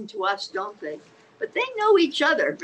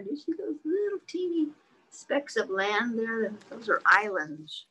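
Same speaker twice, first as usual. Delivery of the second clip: ordinary delivery, nothing marked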